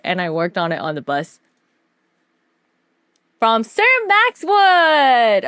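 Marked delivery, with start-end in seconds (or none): 3.4-5.5 high, loud, long-drawn name reveal; grand announcement. Pretend. Streamer revealing who the commission came from, grand announcement. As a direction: proclaim the name slowly like a herald.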